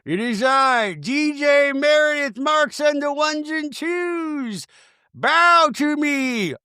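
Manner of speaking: grand high, slow proclamation; high-pitched regal self-announcement; Meredith Marks. Acted. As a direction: declare yourself divine with long-held words. Imagined saint Meredith floating, proclaiming.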